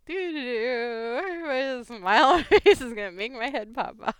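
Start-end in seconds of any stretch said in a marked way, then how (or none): none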